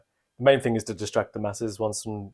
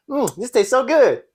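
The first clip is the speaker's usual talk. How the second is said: meme voice; 12 semitones higher, 4 dB louder than usual. Pretend. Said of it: "Stop doing it".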